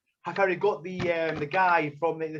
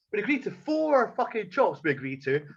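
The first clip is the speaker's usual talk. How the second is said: ordinary talk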